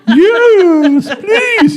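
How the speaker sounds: high-pitched shrill yes please; cartoon falsetto begging; squeaky eager yes. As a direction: squeal it up like an overeager sub. Mock eager submissive reply.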